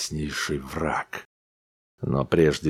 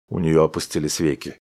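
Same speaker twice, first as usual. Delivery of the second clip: plain reading voice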